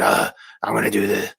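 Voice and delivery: lightly done low Batman voice; a hint of a brooding Batman. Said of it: "he's doing the Batman voice".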